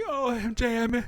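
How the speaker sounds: high-pitched, hammed-up tearful voice; whimpery, exaggerated crying impression; mock sobbing. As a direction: imitate someone sobbing to a lost love. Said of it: "He's sobbing".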